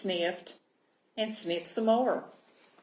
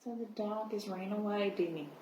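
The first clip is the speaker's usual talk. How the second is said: soft aside; 9 dB quieter than usual. Acted. Teacher asides to children.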